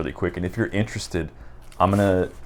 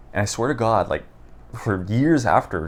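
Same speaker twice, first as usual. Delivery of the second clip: ordinary talk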